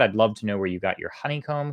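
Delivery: ordinary delivery, nothing marked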